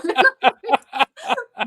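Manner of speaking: high, delighted laugh in character; Russian-accented laugh. Retold or imitated. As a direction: cackle as the Russian guy. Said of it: "in this thick Russian accent".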